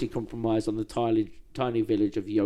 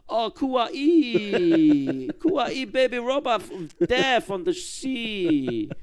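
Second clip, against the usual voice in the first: cooing over babies; 12 semitones higher, 5 dB louder, slower than usual. Real, not acted. Cooing over baby robot ships.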